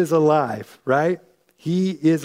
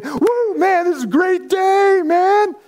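much higher, louder "Woo", high-fiving hype; whooping celebration; 12 semitones higher, 4 dB louder, slower than usual. Pretend. Disciples who should have been celebrating, whooping and cheering. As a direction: cheer it like a chest-bumping buddy. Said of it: "They should have been walking, like, high-fiving".

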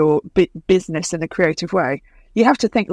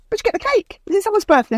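much higher eager coworker; coworker voiced; 12 semitones higher, much faster than usual. Office mates asking about the cake, mock high voice. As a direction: squeak it out like a curious colleague.

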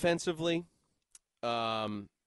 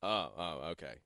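low, quiet coach mumble; Bill; 12 semitones lower, 7 dB quieter than usual. Retold or imitated. Coach realising who the reporter meant, low quiet voice. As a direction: drop low and soft, underwhelmed. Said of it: "He's like".

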